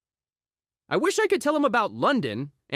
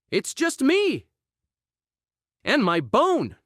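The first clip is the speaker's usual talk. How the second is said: plain reading voice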